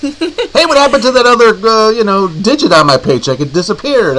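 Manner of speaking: high puzzled worker grumbling; a touch of a baffled employee; confused worker voice. Retold or imitated. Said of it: "like most working stiffs".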